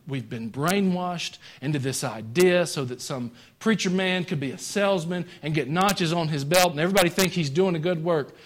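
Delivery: usual speaking voice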